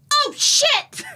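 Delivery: sudden high yelp of alarm; startled cry. Acted. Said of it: "and be like".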